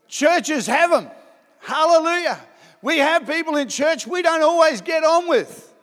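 rising pulpit emphasis; preaching build. Real, not acted. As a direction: lift it up like a preacher. Preacher rising to full force.

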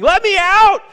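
high, full-force scream; frantic captain yelling at the top of the voice; desperate screaming. Acted. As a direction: scream to be set free. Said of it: "he just starts screaming".

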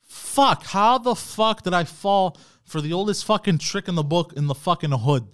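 high, loud exasperated outburst; retold furious rant at his own stupidity; angry self-reproach. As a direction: yell at yourself for being so gullible. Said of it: "I think to myself".

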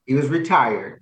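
normal talking voice

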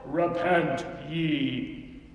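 low, very slow prophet proclamation; Old Testament prophet. Shown as a demonstration. Preacher imitating an ancient prophet, solemn. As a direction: intone it like a stern prophet. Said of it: "sound like an Old Testament prophet".

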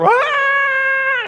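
long, high, loud theatrical wail; mourner's wail. Acted. Professional mourners giving a good send-off, screaming wail. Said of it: "wailing is screaming".

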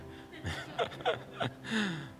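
soft high chuckle; a quiet amused laugh. Real, not acted. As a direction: laugh at your own joke. Laughs after his movie quote.

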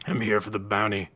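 a lightly voiced growling gunman; Money growling as he brings in the corpses; growled. A narrator's reading. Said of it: "Money growled".

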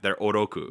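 'oroku' with a single quick tapped R, Japanese style. Shown as a demonstration. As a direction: flick the R in 'oroku' once with the tongue. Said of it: "their R's are one tap".

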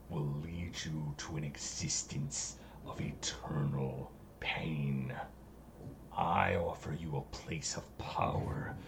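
low, sinister eldritch whisper; whispering entity. Acted. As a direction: hiss it like an ancient evil.

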